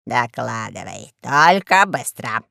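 low, slow lordly command; deep, languid command; bossy order. A narrator's reading. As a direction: order it heavily, drawing out the words. Boss orders a report.

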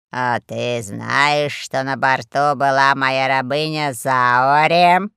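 low, very slow questioning; deep, drawn-out accusing drawl; ominous boss. A narrator's reading. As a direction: press him slowly in a deep voice. Boss presses about his slave.

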